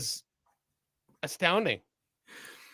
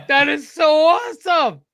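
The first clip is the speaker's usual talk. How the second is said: excited; 10 semitones higher, 8 dB louder than usual. Real, not acted. Delighted reaction to video.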